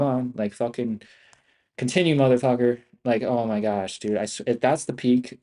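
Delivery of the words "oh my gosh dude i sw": normal talking voice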